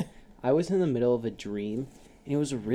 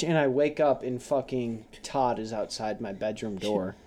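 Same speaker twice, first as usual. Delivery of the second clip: normal talking voice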